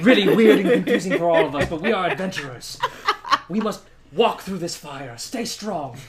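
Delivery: rally them with a heroic speech; rousing speech. Acted. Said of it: "what I say".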